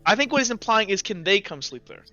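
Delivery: usual speaking voice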